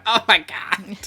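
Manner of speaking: shocked gasp. Retold or imitated. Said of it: "And I'm like".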